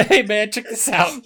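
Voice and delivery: high, loud and giddy with laughter; excited show-off. Retold or imitated. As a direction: show it off like a kid with a new trick. Acting out showing off.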